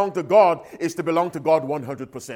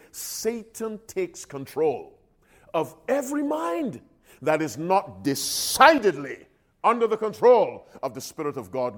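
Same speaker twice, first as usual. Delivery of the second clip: slowly. Shown as a demonstration. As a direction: lay each phrase down slowly, leaning on "mind". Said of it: "Let me say that again slowly".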